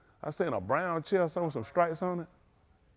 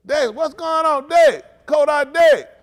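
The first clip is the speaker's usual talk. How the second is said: loud, higher call across the room, slowed; 12 semitones higher, 11 dB louder, slower than usual. Real, not acted. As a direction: hail a friend by name, loud and high. Calling out to a viewer.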